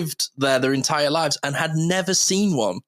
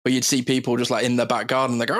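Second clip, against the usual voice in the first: ordinary talk, nothing marked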